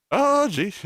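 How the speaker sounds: put-on high, aborted try at a camp Irish accent. Streamer trying a requested voice and giving up, high mock Irish.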